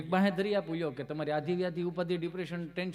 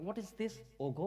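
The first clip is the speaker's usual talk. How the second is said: puzzled city lady; 3 semitones higher, 5 dB quieter, much slower than usual. Retold or imitated. City lady asks in English.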